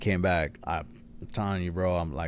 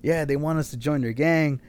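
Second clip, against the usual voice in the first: higher voice for the two little dudes; imitated little guys; 6 semitones higher, 4 dB louder than usual. Quoting two little dudes about joining a gang, pitched-up imitation. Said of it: "They were like".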